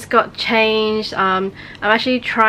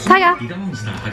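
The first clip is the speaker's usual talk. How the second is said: calling a dog; 9 semitones higher than usual. Real, not acted.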